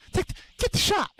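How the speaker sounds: high squeaky shout; high mock yell.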